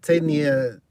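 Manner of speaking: ordinary talk, nothing marked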